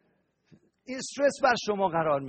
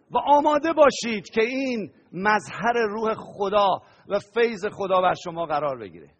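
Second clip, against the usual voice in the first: ordinary talk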